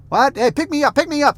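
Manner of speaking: mock toddler whine; imitating a little kid. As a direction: play the toddler begging to be picked up. Toddler begging to be lifted.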